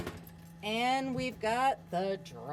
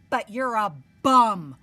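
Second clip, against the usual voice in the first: much louder punchline; loud put-down; 14 dB louder than usual. Acted. Princess telling off the prince, loud. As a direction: slam him with it. Elizabeth tells Ronald off.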